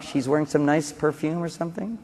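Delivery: ordinary talk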